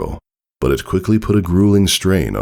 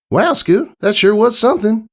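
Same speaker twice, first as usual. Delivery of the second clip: put-on much higher, louder young filly voice, amused; giggly Applebloom; 3 dB louder than usual. Applebloom teasing Scootaloo after her crash, high and giggly. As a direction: tease her friend with a giggle in the voice. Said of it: "Applebloom giggled".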